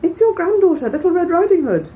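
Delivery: put-on wolf putting on a high girl's voice at the door; disguised wolf voice. A narrator's reading. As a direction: pitch it up to pass as the granddaughter. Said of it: "disguising his voice".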